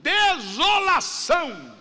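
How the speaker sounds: slow, high, loud shouted desolação; father's cry. Retold or imitated. Preacher voicing a father in anguish naming his son, slow loud shout. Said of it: "o pai disse assim".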